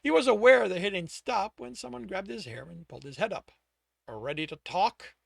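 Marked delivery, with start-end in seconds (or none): none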